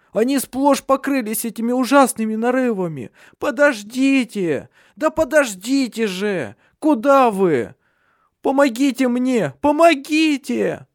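high, strained, desperate cries for help; panicked pleading. Acted. Redhead, burning with sores, begs comrades, high panicked cries. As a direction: beg them not to leave you, voice cracking high. Sick man begs, left behind.

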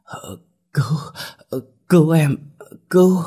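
high choked cries for help; gasping, broken speech. A narrator's reading. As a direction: act out choking, words broken by gasps. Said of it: "Cô thở phì phì nói đứt quãng". (thở phì phì, nói đứt quãng)

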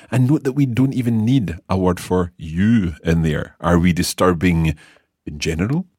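normal talking voice

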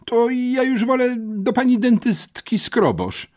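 very high, despairing wail of a pupil; desperate confession. A narrator's reading. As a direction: confess it in despair, pitched right up. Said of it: "wyznał rozpaczliwie". (rozpaczliwe, bardzo wysokie wyznanie)

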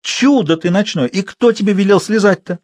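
high-pitched scolding; exasperated old wife's cry; old woman shouting. A narrator's reading. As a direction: berate him crossly in a high voice. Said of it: "кричала во тьме старуха".

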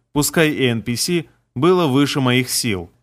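plain reading voice

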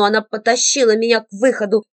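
ordinary delivery, nothing marked

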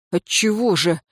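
usual speaking voice

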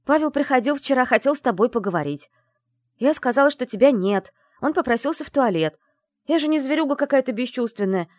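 high, loud rushed confession; blurting confession. Acted. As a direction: spill it all at once. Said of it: "Как на духу выложила я".

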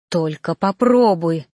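slow, firm threat; warning. A narrator's reading. Narrator grabs the reaper's hand, warning. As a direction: dare him to try.

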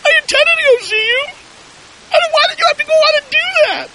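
imitating a tearful woman, very high and shrill, ending in a shout; upset woman crying. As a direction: squeal her reproach in a high falsetto voice, like an upset caller. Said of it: "she called me back crying, all upset".

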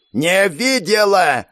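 slow, high furious yell. A narrator's reading. Sergeant yells at soldier, slow and furious. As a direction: roar it before the slap. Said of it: "заорала сержантка".

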